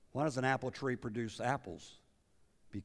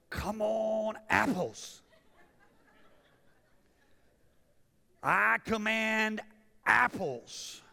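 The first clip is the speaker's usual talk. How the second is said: high, strained voice of a tree urging its apples out; talking apple tree; 10 semitones higher, 4 dB louder, much slower than usual. Pretend. Pastor voicing a tree ordering apples to grow, high and strained. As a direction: play the tree begging, then commanding its fruit. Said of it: "do you hear the tree going".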